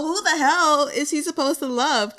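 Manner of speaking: ordinary talk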